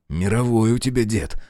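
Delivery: usual speaking voice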